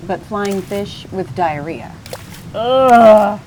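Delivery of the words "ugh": loud grossed-out groan; loud ugh of disgust. A narrator's reading. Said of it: "said Albertini".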